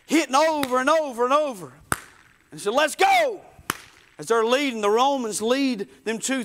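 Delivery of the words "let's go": high, loud acted command; shouted order. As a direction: yell it like a soldier driving prisoners on. Roman soldier's order, acted.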